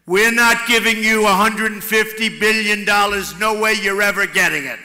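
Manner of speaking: loud, hard-line refusal to hand over money; tough demand. Retold or imitated. To the Iranians, the tough way, a loud flat refusal. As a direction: slam the table and refuse outright. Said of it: "I'll be screaming at 'em about the money".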